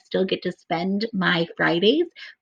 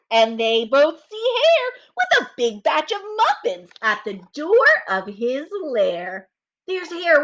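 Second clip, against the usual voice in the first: put-on slow, lively read-aloud for children; storybook reading; 8 semitones higher, 4 dB louder, slower than usual. A narrator's reading. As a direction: read it like story time for little kids. Reads story narration aloud.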